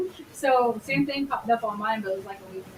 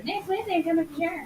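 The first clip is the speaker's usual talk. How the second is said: high, soft mimicking voice; put-on viewer voice; 5 semitones higher, 3 dB quieter, faster than usual. Host echoing a viewer's promise to come, high mock voice. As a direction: echo it in a silly higher voice.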